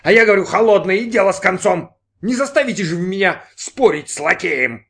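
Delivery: loud, high, final rebuttal; indignant insistence. Acted. Brin overrules the waiter about eggs, loud indignation. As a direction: shut the argument down loudly.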